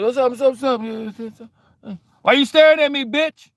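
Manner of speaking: high, loud, bold greeting; loud phone greeting. Retold or imitated. Man on the phone answers starers, high and loud. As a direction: call it out with swagger. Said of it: "I'll be on".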